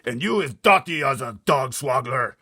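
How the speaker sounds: loud high retort; BFG crying out. Acted. BFG insulting the giant, crying out. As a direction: fire back the insult. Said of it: "cried the B F G".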